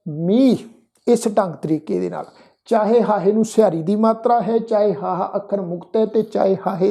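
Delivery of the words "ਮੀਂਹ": ਮੀਂਹ said as a long miː, no sihari on the final h; teaching pronunciation. Shown as a demonstration. Teacher modelling ਮੀਂਹ for the sangat, final h silent. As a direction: model ਮੀਂਹ slowly and clearly, h unsounded. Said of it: "ਉਹ ਉਚਾਰਨ ਬਣੇਗਾ ਜੀ".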